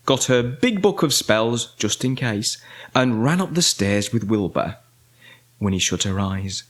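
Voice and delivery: usual speaking voice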